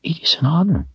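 tearful, high and trembling; trembling whisper. A narrator's reading. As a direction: whisper through tears. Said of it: "Denna whispered through tears".

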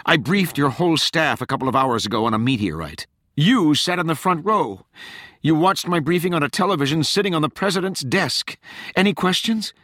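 high, indignant rebuke; fuming. A narrator's reading. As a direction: throw the facts at them, high and heated. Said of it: "Rachel fumed".

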